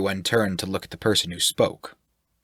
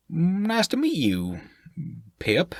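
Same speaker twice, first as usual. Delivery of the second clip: careful, hesitant greeting; 10 semitones higher, slower than usual. Acted. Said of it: "paused before saying the name".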